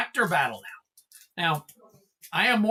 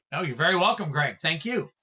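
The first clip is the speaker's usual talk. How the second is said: ordinary delivery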